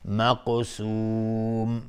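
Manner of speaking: مقسوم with the final long u held very slowly; elongated madd. Shown as a demonstration. Teacher demonstrating madd on مقسوم, drawn out slowly. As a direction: stretch the vowel of مقسوم. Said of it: "مد عارض سکون به مقدار دو یا چهار یا شش حرکت".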